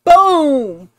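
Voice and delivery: loud, high single-word burst; triumphant. Real, not acted. Celebrating after the friend calls it smart, triumphant. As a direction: boom it out in victory.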